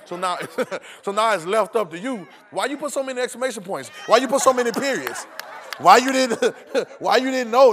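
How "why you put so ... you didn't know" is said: high, loud challenge; agitated questioning. Acted. Texter misreading punctuation, loud mock-indignant questioning. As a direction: fire off questions as a mock accusation. Acted quarrel over a text.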